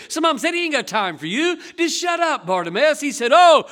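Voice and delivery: loud, high fervent preaching; preaching at full force. Acted. Preacher to congregation, loud fervent preaching.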